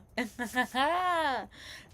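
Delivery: high-pitched, drawn-out laugh; real laugh. Real, not acted. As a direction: laugh at your own story of getting caught. Laughing at getting caught.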